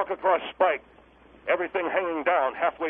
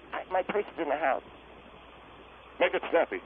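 normal talking voice

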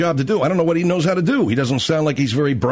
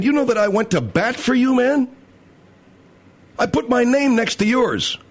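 aggrieved backer; 8 semitones higher than usual. Retold or imitated. Voicing the backer's complaint.

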